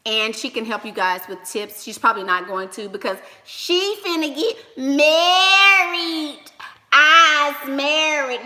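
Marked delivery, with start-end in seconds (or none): 3.4-8.4 sing-song teasing, higher and louder; slow teasing. Real, not acted. To the group about Mia, slow sing-song teasing. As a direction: drag it out to tease her.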